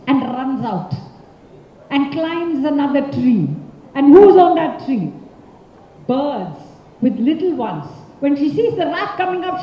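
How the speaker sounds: usual speaking voice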